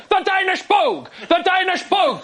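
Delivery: high-pitched mock-angry insistence; getting angry. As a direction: imitate an exasperated dad repeating himself. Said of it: "Started to get angry now".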